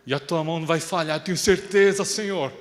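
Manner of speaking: loud, confident prayer; young man praying for revenge, loudly; fervent prayer. Retold or imitated. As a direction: declare faith loudly to God. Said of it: "Eu acordava".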